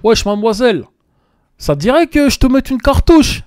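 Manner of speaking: drague de racaille, plus aiguë et plus forte; dragueur charismatique. Acted. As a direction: joue le beau parleur lourd qui aborde une fille. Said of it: "c'est charismatique ou c'est pas charismatique?". (gros dragueur de cité qui lance sa réplique fort)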